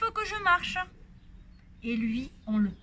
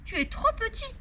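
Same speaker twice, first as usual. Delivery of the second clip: higher firm mother voice; stern mother; 9 semitones higher than usual. Acted. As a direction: lay down the rule like mum.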